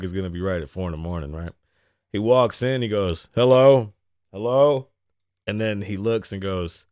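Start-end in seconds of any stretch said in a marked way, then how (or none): 3.3-4.9 louder, questioning hello; erratic man calling hello. Retold or imitated. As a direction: call out as the odd stranger walking in. Voicing homeless man calling out.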